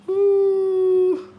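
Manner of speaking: ordinary talk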